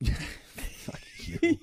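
playful swear through a laugh; giggling mock insult to partner. Real, not acted. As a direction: laugh it out at your teasing partner.

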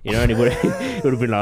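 ordinary talk, nothing marked

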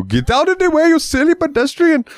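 very high, exaggerated cartoon Indian accent; imitated cartoon shopkeeper scolding in an Indian accent; Apu. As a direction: yelp it in a mock Indian accent. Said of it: "you would be driving as Apu".

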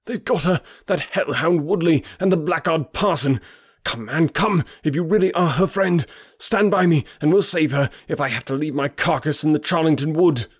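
high, agitated pleading; rally them in frantic despair; desperate outcry. A narrator's reading. Stranger begging men to help save her, high desperate cry. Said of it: "in an ecstasy of despair".

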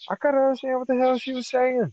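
high exasperated complaint; incredulous outburst about the accent. Real, not acted. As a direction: throw up your hands in disbelief.

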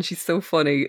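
ordinary talk

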